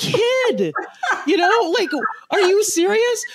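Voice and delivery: put-on high-pitched, exasperated disbelief at a kid; playful high-voiced parental incredulity; mock incredulous. Mother reacting to daughter's upset.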